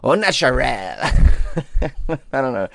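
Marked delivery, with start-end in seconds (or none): 0.0-1.0 "Au Naturel" in a hammed-up mock French accent. Testing "Au Naturel" on listeners in a mock French accent. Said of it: "That's my French accent". 1.0-2.3 short airy laugh at himself; breathy laugh. Real, not acted. After his French accent, an airy laugh at himself.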